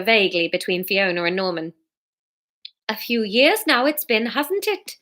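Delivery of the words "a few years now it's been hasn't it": Welsh attempt gone Geordie; slipped into Geordie. Fiona answers Jack, accidentally Geordie-accented. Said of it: "she's gone, Geordie, now".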